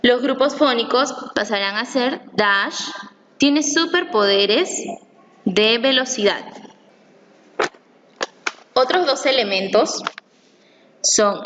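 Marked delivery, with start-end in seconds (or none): 2.3-6.4 Dash, pause, tiene superpoderes, pause, de velocidad; pauses after Dash and after superpoderes, each group set apart; split into phonic groups. Shown as a demonstration. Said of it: "Este consta de tres grupos fónicos".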